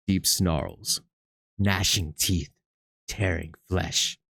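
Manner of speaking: low, slow, grim description; ominous narration. A narrator's reading. Game master describes the cat fight grimly. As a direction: let each image land darkly.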